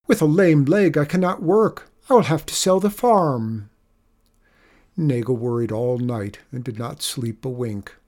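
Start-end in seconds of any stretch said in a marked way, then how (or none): 0.1-3.7 high, louder storybook lament; groaning. A narrator's reading. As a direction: bemoan certain ruin. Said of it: "groaned Nagel".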